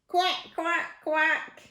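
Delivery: high duck-like quacks; dog quacking. Acted. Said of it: "dainty dog began to quack".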